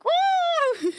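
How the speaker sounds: high, long "woo" imitating dad; cartoonish yelp. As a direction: yelp like a cartoon cat shocked by cold. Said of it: "he was doing that".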